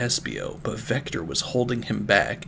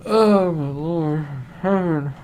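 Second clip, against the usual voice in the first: stunned disbelief; 6 semitones higher, slower than usual. Real, not acted. Reader stunned by the story.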